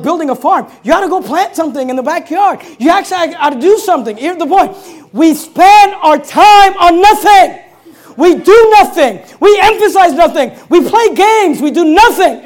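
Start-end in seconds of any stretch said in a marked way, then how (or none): 5.1-12.4 slow, loud, high preaching shout on every nothing. Real, not acted. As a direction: hammer the point at full voice, slowly. Preaching climax at full force.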